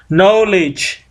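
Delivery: knowledge with a silent k, said "noledge", slow; correct pronunciation. Shown as a demonstration. Teacher modelling the right way to say knowledge, silent k. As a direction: drop the k in knowledge, start on the n.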